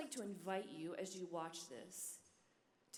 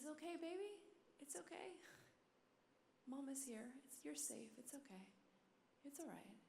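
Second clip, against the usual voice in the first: high, hushed mother's comfort, settling softer; tender soothing; 7 semitones higher, 6 dB quieter than usual. Retold or imitated. Mom to her sobbing little girl, tender soothing. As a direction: soothe a crying little one, almost whispering.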